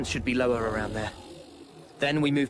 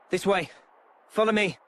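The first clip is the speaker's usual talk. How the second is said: raised voice urging the group to follow; calling out; 7 semitones higher, 4 dB louder than usual. Acted. Leader calling his party to follow him, raised voice. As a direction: call the others along, louder.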